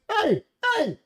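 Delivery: high-pitched mock call after someone; high, urgent retold "stop there" call. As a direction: imitate an official hailing a runaway. Official chasing George, calling out.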